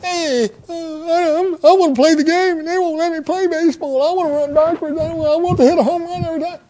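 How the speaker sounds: high, loud mock-tearful whine; a teen carrying on like a toddler; whining thirteen-year-old. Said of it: "How would that look on a thirteen-year-old".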